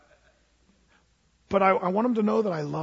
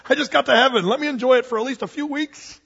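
laughing protest; 6 semitones higher, 5 dB louder, faster than usual. Acted. Begging to enjoy heaven.

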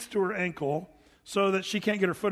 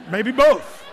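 laughing; 5 semitones higher, 11 dB louder than usual. Real, not acted. Joke about demon-possessed Californians.

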